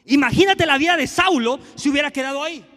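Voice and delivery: emphatic preacher repeat, "Imagínate" hit hard. Shown as a demonstration. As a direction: punch out "Imagínate" loud, then drive the rest home. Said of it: "Se la pongo en español".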